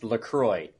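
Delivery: LaCroix ending in "kroy"; company pronunciation. Shown as a demonstration. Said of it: "says that it's pronounced LaCroix".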